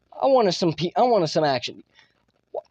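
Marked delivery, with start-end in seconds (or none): none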